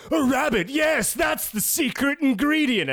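high, loud, slow shouting chef; hammed-up excited chef shout. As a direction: shout it like a thrilled chef with a discovery. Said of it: "and they're like".

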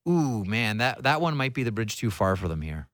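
ordinary delivery

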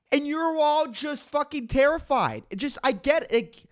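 ordinary talk

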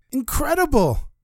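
high, wide-eyed marvel; amazed. Acted. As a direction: gasp at it in wonder. Janitor amazed by the papers.

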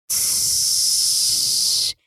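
imitated hiss of a leaking sprinkler; a quick unvoiced tss for the leak; hissing leak noise. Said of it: "And we hear".

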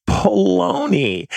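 high, drawn-out exclamation of scorn; slow, exaggerated mocking exclamation; scornful dismissal. Real, not acted. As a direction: dismiss it with a long, high-pitched stretch. Scornfully dismissing the false belief.

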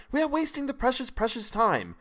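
high, desperate cry; cried out. A narrator's reading. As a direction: plead high and urgent. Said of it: "I cried".

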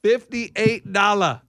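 slow, stretched-out word by word; drawn-out price announcement. Pretend. Card owner showing off the price, drawn-out boast. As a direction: savour each word slowly. Playfully announcing the card's value.

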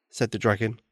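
plain reading voice

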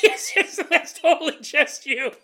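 high, loud, giggly reply; laughing agreement. Acted. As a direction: agree emphatically through laughter. Imagined listener disagrees, through laughter.

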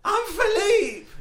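high loud bewildered cry; panicked confusion. Acted. Philippe confused by the name, high loud panic.

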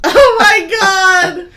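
high loud drawn-out cry of disbelief; shocked shout. Real, not acted. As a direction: shriek it out, scandalized. Reacting to crude screen name.

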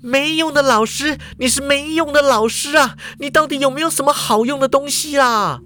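loud shout. A narrator's reading. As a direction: yell it at the teacher. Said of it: "大声地说".

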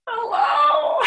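high, choked-up hello breaking into tears; a squeaky hello as the crying starts; tearful hello. Retold or imitated. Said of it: "I'm like".

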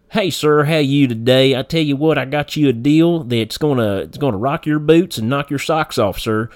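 loud, high-pitched country Kentucky sales pitch; rural Kentucky telemarketer. Acted. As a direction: lay on a thick backwoods Kentucky accent. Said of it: "not how you hear me speaking right now, very articulate and clear".

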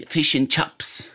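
New Zealand accent, fish as fush, chips as chops. Shown as a demonstration. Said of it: "like they say".

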